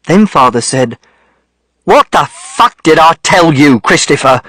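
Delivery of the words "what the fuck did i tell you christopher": father's angry demand, only somewhat raised; contained angry outburst; louder anger. A narrator's reading. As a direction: raise the voice a touch, demanding an answer. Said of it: "This was much louder".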